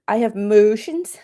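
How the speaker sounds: imitating her drawn-out motions with a light twang; lightly mock her drawl, motions stretched out; mock Southern accent. Streamer retells a woman's hearing: lightly mocked drawl. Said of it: "Southern accent".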